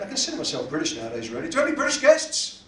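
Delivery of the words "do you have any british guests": loud, high shout-out to the crowd; raised, high emcee call. Real, not acted. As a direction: throw the question out to the whole room. Calling out to the audience.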